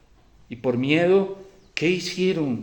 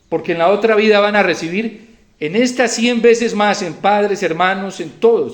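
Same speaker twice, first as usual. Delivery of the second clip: raised, loud preaching voice; forceful preaching; 7 semitones higher, 9 dB louder than usual. Real, not acted. Preacher promising the congregation a hundredfold reward, forceful preaching. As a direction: proclaim the promise emphatically.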